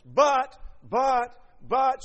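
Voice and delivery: three booming buts, then slow; preacher to congregation, pounding the contrast, loud and emphatic. Real, not acted.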